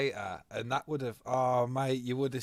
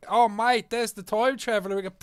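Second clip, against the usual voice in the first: loud, raised mock-amazed commentary; quick, higher-pitched imitated exclamation; Aussie Man imitation; 6 semitones higher, 8 dB louder than usual. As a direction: call it out like an excited video reviewer. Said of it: "he'd go".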